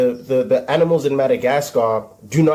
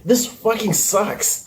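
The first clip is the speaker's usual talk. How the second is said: high, slow, drawn-out complaint; comic despair, pitched way up; exasperated whine; 9 semitones higher, slower than usual. Real, not acted. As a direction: whine it like the thought won't leave. Exasperated over looping thought.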